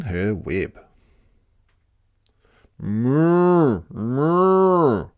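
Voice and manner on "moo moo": long, loud mooing; cow's moo. Acted. Cow calling to the busy spider, loud moo. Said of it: "said the cow".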